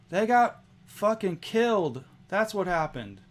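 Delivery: high, loud bitter snap; angry retort. Acted. Brunhild answering Istrid over the corpses, angry and loud. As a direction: throw the answer back in her face.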